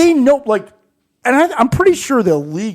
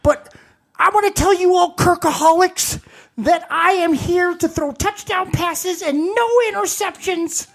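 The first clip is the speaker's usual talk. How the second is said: wrestling promo; 9 semitones higher than usual. Pretend. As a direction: cut a promo like a hyped wrestler. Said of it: "mix with a wrestling promo".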